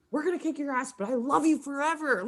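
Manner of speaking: put-on gushing drunk fan, pitched high; mock tipsy high-pitched declaration of devotion; hammered fan. Hammered fan meeting a rival player, gushing high. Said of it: "I was hammered".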